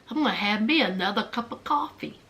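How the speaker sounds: low, soft, quick imitation of indifference; nonchalant. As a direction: toss it off quietly and low. Sister's unbothered reply, acted.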